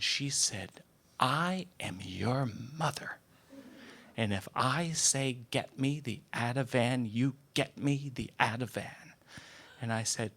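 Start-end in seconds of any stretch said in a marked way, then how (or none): 1.2-9.1 whisper. Retold or imitated. Mother commands son in secret.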